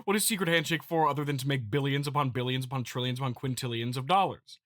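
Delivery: normal talking voice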